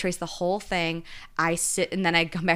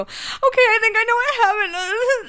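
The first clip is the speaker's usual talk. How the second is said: much higher, louder excited rush; excited realization; 6 dB louder than usual. Retold or imitated. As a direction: blurt it out, thrilled to have figured it out.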